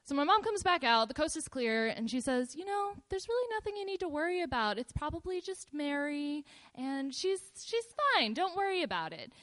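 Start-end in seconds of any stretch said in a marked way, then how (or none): 2.6-9.3 higher, easy soothing voice; reassuring mom. Retold or imitated. Mom telling scared daughter the house is clear, breezy reassurance. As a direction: play it down lightly in a raised voice.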